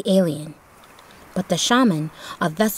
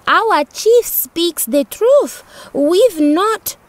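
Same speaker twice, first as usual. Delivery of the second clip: insistent shaman; 10 semitones higher, 5 dB louder, much slower than usual. A narrator's reading. Shaman defends chief.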